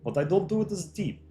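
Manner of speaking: put-on Russian-ish accent. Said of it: "It's like Russian accent".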